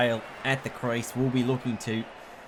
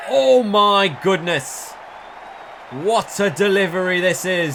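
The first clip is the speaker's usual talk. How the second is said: sudden English accent, slow and much louder; gasped English-accented exclamation, higher and louder; 6 semitones higher, 7 dB louder, slower than usual. Real, not acted. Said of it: "Sorry, I don't know why I sounded English then".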